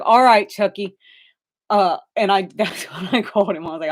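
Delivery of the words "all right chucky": loud startled reply. Retold or imitated. Photographer jumping back from the creepy kid, loud and startled. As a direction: jump back and blurt it out.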